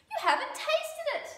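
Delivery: high, loud insistent squeak; high animal voice. A narrator's reading. Animals trick Dingo into tasting, high insistent voice. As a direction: pitch it right up, insisting.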